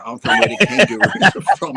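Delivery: squeaky high laughing reply; laughing high-pitched comeback; mock exasperated retort. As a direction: toss it back with a laugh, exasperated. Mocking answer to the cop.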